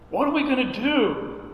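high, hammed-up fretting question; mock-helpless plea, pitched well up; imitated worried people. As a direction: mimic people at a loss, pitch swooping up. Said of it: "People would say".

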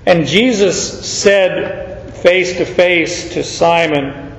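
slow, every word leaned on; emphatic. Shown as a demonstration. Preacher stressing Jesus met Simon face to face. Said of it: "it's emphatic".

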